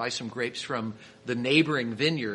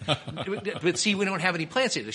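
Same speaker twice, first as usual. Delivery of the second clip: high, stammered mock objection; retold sputtering protest with a laugh in it; acted feeble protest; 7 semitones higher than usual. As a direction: get a word in, pitched up and amused.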